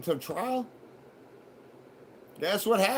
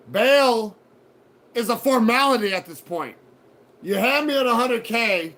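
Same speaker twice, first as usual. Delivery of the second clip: loud, scornful speech to the judge; defiant retold rant; 3 semitones higher, 7 dB louder than usual. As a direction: lay it out to the judge like he's a fool.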